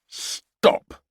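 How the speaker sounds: mock drill-sergeant shout; parade ground roar. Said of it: "roared in his best parade ground voice".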